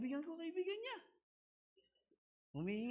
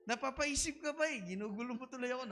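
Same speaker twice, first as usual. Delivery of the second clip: normal talking voice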